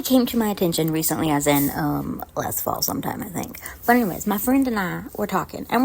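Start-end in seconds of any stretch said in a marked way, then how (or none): 2.3-3.6 soft, unvoiced aside under her breath; whispered aside. Real, not acted. Aside about when it happened.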